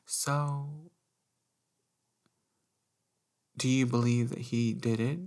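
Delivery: prying reporter. Retold or imitated. Voices a reporter probing her.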